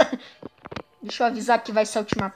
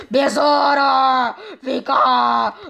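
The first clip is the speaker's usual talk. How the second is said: slow, louder drawn-out wail; hammed-up crying call; mock wail; 3 dB louder, much slower than usual. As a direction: call after him in mock despair. Wailing call after friend.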